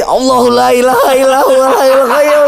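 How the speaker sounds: high, comic panicked recitation; baca ayat kursi panik. Retold or imitated. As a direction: imitate a frightened man blurting out a prayer. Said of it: "Saya baca lagi ayat kursi". (tiruan baca doa panik, nada tinggi)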